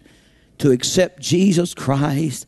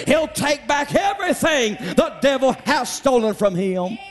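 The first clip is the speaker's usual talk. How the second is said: high, fervent sermon voice, slowing into it; preaching at full force; 9 semitones higher than usual. Acted. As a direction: preach it up, building. Preacher building up.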